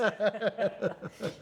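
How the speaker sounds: a bright burst of laughter; high laugh. Real, not acted. Host laughing at the guest's joke, a high laugh. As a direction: laugh along at the shared joke.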